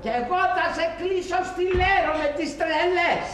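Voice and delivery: shouting back, pitch flying high; a sudden furious outburst. Acted. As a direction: bellow it straight back at her. Furious husband answers his mother.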